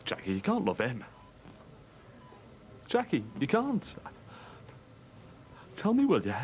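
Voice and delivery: a betrayed husband pleading with his wife. Acted. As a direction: beg her to deny it.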